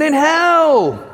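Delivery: high, loud punchline; emphatic answer. Retold or imitated. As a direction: drive the answer home hard. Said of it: "And he went".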